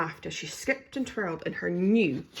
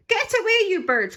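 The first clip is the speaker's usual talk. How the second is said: high, loud shooing cry; 14 semitones higher, 8 dB louder than usual. A narrator's reading. Daisy chasing birds away, shouting. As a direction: chase the birds off with a shrill shout. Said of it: "cried Daisy".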